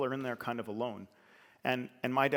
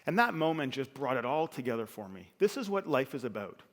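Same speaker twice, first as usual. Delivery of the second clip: usual speaking voice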